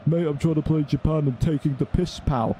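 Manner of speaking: sneering put-on voice mimicking the Japan team; mock them in a dumb lad's voice; scornful impression of a rival player; mocking opponent voice. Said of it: "You're not in fucking twenty eighteen on PlayStation".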